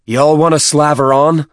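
loud high drill sergeant shout. A narrator's reading. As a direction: yell it across the pit. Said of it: "Byrne shouted".

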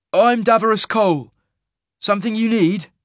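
a bold traveller hailing a wagon master; Cole's voice. Acted. Narrator voicing Cole hailing the teamster, brisk and loud.

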